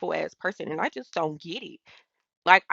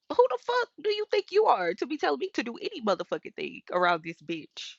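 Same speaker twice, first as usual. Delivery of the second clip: indignant outburst; 6 semitones higher than usual. Real, not acted. Angry rant at the commenters.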